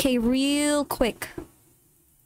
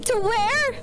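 panicked; 6 semitones higher, slower than usual. Real, not acted. Chased, asked to run.

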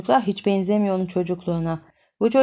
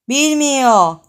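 put-on girl's exclaim; 4 semitones higher, 7 dB louder than usual. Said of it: "daha sinirleniyor".

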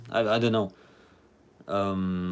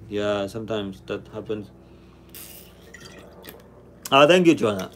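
normal talking voice

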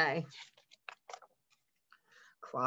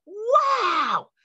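excited wow; 4 dB louder than usual. A narrator's reading. Brother reacts to Clyde's news.

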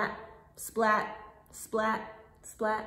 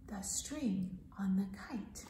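soft low whisper; 4 semitones lower, 12 dB quieter than usual. Acted. Teacher whispering that the kite string broke. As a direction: hush the bad news. Sad turn in story.

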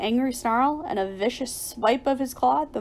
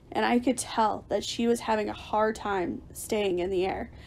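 usual speaking voice

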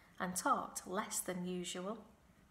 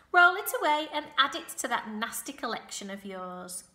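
loud, quick, higher-pitched scolding; disdainful dismissal; 4 semitones higher, 9 dB louder, faster than usual. A narrator's reading. Goose scorning Templeton's nasty collection, disdainful dismissal. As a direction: send the rat packing with disdain.

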